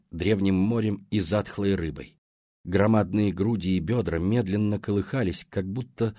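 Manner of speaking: ordinary delivery, nothing marked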